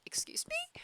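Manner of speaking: high, soft incredulous squeak; offended disbelief. Retold or imitated. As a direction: squeak it as if insulted. Quoting own offended reaction.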